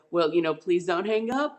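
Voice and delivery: ordinary talk, nothing marked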